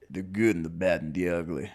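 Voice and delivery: a second go at a cowboy. Shown as a demonstration. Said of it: "A little better".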